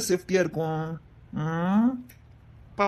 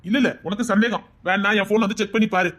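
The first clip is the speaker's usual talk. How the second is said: usual speaking voice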